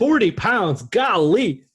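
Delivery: high, loud astonished exclamation; wowed and incredulous; loud amazement. Real, not acted. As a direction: marvel out loud at it, pitch leaping up. Amazed at the weight loss.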